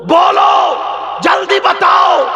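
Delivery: rising into a high, slow, loud demand; building to a shout. Acted. Fatima urging Bilal to tell her, rising to a shout.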